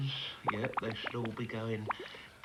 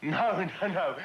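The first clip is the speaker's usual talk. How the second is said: ordinary talk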